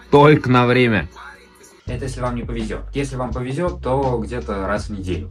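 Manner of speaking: usual speaking voice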